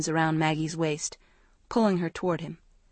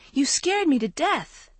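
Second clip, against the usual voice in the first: scolding cry; 8 semitones higher than usual. A narrator's reading. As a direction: scold him, shaken and relieved. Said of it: "she cried".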